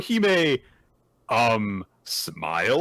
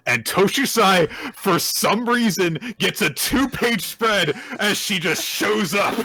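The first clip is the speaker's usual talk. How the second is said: excited hype; 8 semitones higher, 5 dB louder than usual. Real, not acted. Hyping Toshusai's dramatic entrance.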